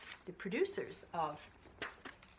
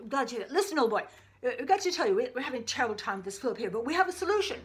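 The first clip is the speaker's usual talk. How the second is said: higher, louder hearty doctor calling a colleague; old-time doctor on the phone; 3 semitones higher, 6 dB louder than usual. Acted. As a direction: play a jovial old doctor shouting down a phone line. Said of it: "listen in on a conversation with two doctors".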